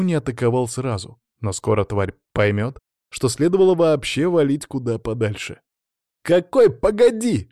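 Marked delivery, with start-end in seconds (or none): 6.2-7.5 high, loud, drawn-out snarl; angry roar. A narrator's reading. Alexei to Sanya who asked to wait, roaring. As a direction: roar back in exasperation. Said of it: "рыкнул Алексей Городецкий".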